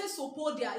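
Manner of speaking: Sopodia with the opening P silent; correct pronunciation. Shown as a demonstration. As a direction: say Sopodia starting straight on the S. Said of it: "it's another thing you will write down".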